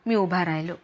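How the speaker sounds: ordinary delivery, nothing marked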